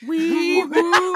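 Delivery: hammed-up siren call; mock siren wee woo. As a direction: imitate a siren, playful and loud. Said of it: "you just hear".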